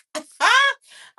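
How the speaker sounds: shrieking laugh; loud high laugh. Real, not acted.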